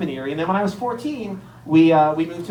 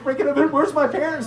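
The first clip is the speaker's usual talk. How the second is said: put-on scared kid; 10 semitones higher than usual. Little kid left alone, crying.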